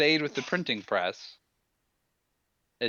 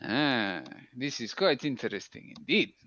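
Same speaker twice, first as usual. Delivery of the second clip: ordinary talk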